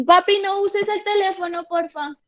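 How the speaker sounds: calling out to papi. Real, not acted. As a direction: call over to papi, pitched well up. Calls aside to papi at home. (llamando a papi, en tono agudo)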